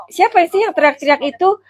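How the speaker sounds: usual speaking voice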